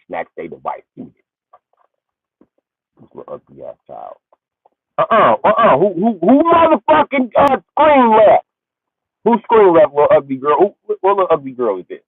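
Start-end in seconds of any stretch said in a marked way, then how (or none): 5.0-12.0 high, loud indignant mother voice; shrill-free outraged parent squawk; mock outraged mom. Mother catching a girl in the group chat, outraged.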